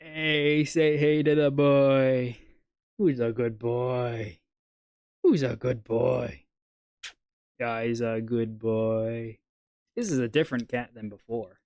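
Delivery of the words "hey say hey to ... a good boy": talking to a cat. Real, not acted. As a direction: coo at the cat lovingly. Greeting and praising the pet cat.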